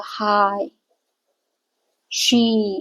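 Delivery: हाय and शी with the vowel drawn out long; long vowel demo. Shown as a demonstration. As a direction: stretch the vowels of हाय and शी for students. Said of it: "देखो long बोल रहे हैं इसको".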